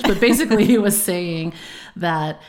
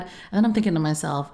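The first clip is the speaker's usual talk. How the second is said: normal talking voice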